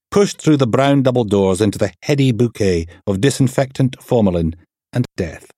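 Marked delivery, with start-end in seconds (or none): none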